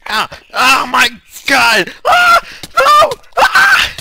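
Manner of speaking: very loud, piercingly high screams; real screaming. Real, not acted. Gamer losing everything in game, panicked screaming. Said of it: "Man, I'm screaming".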